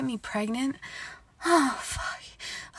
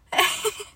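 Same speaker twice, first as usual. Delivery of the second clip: high breathy giggle; 10 semitones higher than usual. Acted. Giggle after sure answer.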